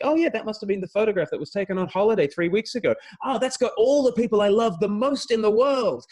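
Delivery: high-pitched, excited inner voice; gleeful anticipation. Acted. Thinking of a tagged holiday photo, excited and high. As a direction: gush with rising delight.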